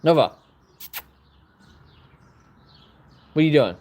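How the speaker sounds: loud high call to the dog. Real, not acted. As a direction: call out to stop her. Calling dog sharply.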